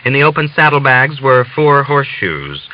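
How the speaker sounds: ordinary delivery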